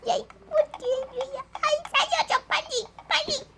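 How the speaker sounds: frantic high plea. Acted. Trapped, begging urgently.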